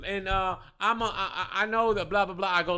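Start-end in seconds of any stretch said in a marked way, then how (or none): none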